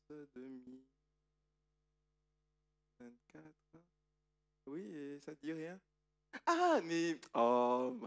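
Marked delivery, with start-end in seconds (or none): 6.5-8.0 loud, high mock surprise; hammed-up astonishment; feigned surprise. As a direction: [name] plays innocent with a big exclamation.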